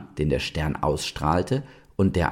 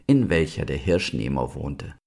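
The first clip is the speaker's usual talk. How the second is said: ordinary delivery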